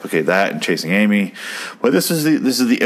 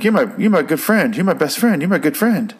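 retold kid's screaming; 8 semitones higher than usual. Kid Henry insisting on friendship to Bully, retold as a high mock scream. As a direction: imitate a pleading scream at a high pitch. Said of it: "Henry keeps screaming".

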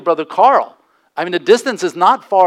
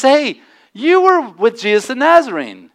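higher, louder challenge voicing the servant girl; raised-voice accuser in a retelling; bold accusation; 7 semitones higher, 5 dB louder than usual. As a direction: call him out boldly and loudly. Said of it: "she just boldly speaks out".

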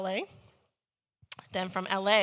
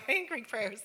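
ordinary talk

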